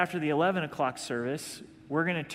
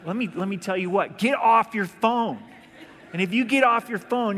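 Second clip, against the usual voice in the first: mock scolding shout, higher and louder; exhorter scolding; 4 semitones higher, 6 dB louder than usual. Exhorter scolding a driver on the phone, mock shout. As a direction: bark it like a blunt exhorter. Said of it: "if you're an exhorter".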